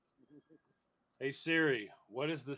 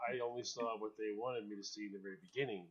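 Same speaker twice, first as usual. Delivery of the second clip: normal talking voice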